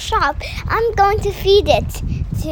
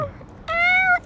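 cat meow; 11 semitones higher than usual. Acted. Child meows to cat.

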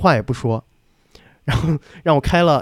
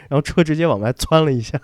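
ordinary delivery

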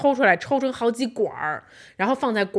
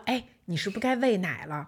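softer, hushed, careful not to wake her; gentle soft question; 3 semitones lower, 8 dB quieter than usual. Retold or imitated. Husband tiptoeing to his sleeping wife, softly asking. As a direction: ask softly so you don't disturb a sleeper. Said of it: "我都已经很轻言细语地问你了".

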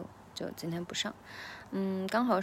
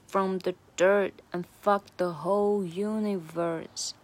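slowly recited practice take; slow, measured read of the verse; slow version; 3 dB louder, slower than usual. Shown as a demonstration. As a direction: draw each line out slowly for learners. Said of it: "慢速版".

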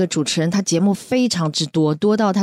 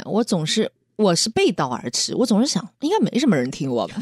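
normal talking voice

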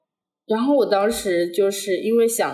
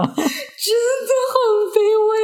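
very high, slow mock-tearful whine; plaintive wail; 13 semitones higher, 3 dB louder, much slower than usual. Real, not acted. Student agrees with a friend she was humiliated, in a plaintive wail. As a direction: drag it out as a comically pitiful lament. Comic lament about her own humiliation.

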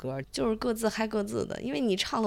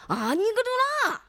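Martian gibberish; 3 dB louder than usual. Pretend. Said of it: "录一期火星语播客".

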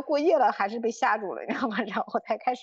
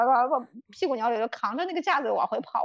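ordinary talk, nothing marked

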